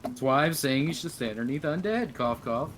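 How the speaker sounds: ordinary delivery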